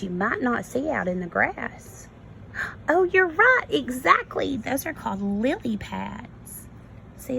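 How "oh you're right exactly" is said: teacher praise. Real, not acted. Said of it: "teacher praising a child's answer".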